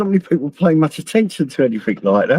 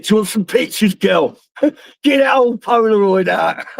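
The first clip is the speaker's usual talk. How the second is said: higher, half-laughing mock line, breaking into a laughing shout; cheeky pretend chat-up line, laughing; joking cheeky offer; 6 semitones higher than usual. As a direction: tease her through a laugh. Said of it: "Yeah, I should have said".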